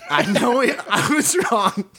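helplessly amused quote; laughing. Real, not acted. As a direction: crack up while quoting the confession. Reading the umpire's confession, amused.

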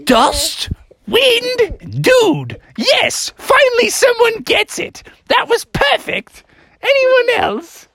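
high falsetto teacher, impressed; Mother Goose. Acted. Teacher finally approves Bill's answer, high delighted goose. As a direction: build from wonder to high delight. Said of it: "And the teacher was like".

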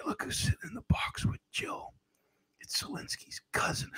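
hushed, secretive whisper; confiding presidential whisper impression; Brandon going soft. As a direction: drop abruptly to a breathy whisper. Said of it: "then I go soft".

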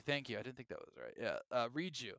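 ordinary talk

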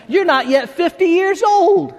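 high, drawn-out mock disbelief; incredulous challenge.

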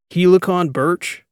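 loud, high, drawn-out incredulous question; amused disbelief. A narrator's reading. Captain discovers an absurd cover name, amused disbelief. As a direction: read out a ridiculous name, slowly and loudly. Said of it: "I couldn't help but laugh".

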